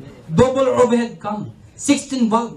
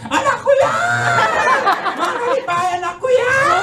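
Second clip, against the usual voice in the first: much higher, laughing proud shout; laughing, bragging mother; 13 semitones higher than usual. Retold or imitated. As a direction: crow it out like a proud provincial mom. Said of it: "Pagkakita sa akin".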